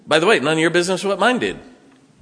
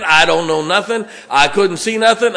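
ordinary delivery

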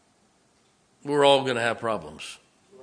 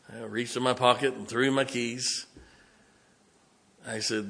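usual speaking voice

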